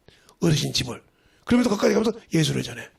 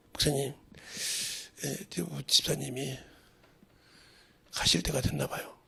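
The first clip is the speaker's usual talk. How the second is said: soft, low, grave news; hushed report; 5 semitones lower, 10 dB quieter than usual. Retold or imitated. Telling the pastor a church deacon is dying. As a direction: murmur it gently, as at a deathbed.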